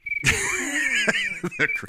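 high breathy laughing fit; burst of laughter. Real, not acted. Cracking up at the co-host's bad joke, helpless laughter.

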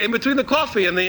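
ordinary talk

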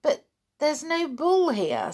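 high-pitched, puzzled surprise; surprised. A narrator's reading. Missus Andrews answers the panicking Cecil, surprised. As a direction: lift the pitch in disbelief. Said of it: "said Missus Andrews in surprise".

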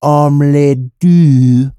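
slow, drawn-out mock French; exaggerated French accent. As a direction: roll it off your tongue like a French guy. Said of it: "he tries to roll it off his tongue like a French guy".

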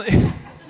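a quick breathy laugh; light laugh. Real, not acted. Speaker laughing about the couple's baby, a quick breathy laugh. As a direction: laugh with delight.

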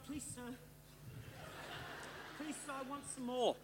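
near-whispered Oliver Twist plea; orphan begging. Retold or imitated. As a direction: beg like a timid orphan. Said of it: "Some of us walk in like this going".

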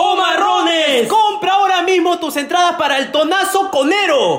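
high, forceful hard-sell shout; shouted promo. Pretend. Promoter urging fans to buy Tonazo tickets, high shouting. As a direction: yell it like an event advert.

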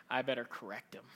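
low, soft self-righteous grumble; mock curmudgeon. Preacher mimics a scold reading Twitter, low mock disapproval. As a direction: grumble it low, as a lightly mocked busybody.

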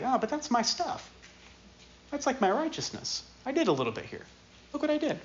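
high, soft whining Abraham; Abraham pleading with Sarah in a high whine; whiny protest. Acted. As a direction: whimper like a child guarding his toys. Abraham voiced clinging to his works.

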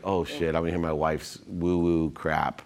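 retold thought with a touch of exasperation; eye-rolling dismissal. Skeptical passenger dreading his wife's woo-woo talk, mildly dismissive. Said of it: "I literally rolled my eyes".

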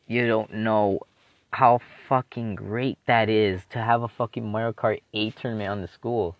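normal talking voice

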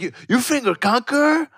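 shocked high question. Retold or imitated. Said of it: "And it's like".

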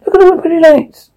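very high, quick character voice; distorted Poker. A narrator's reading. Poker repeating the pretty lights line, very high distorted voice. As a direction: squeak it out fast and very high. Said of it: "Poker distorted".

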